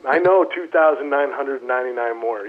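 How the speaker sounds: usual speaking voice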